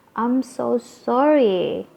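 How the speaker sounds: "I'm so sorry" drawn out slowly, with weight on "so sorry"; intonasi ditekankan. Shown as a demonstration. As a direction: stretch "so" and "sorry" to sound truly apologetic. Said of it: "kalau kita menekankan intonasinya". ("I'm so sorry" diucapkan pelan dengan intonasi ditekankan)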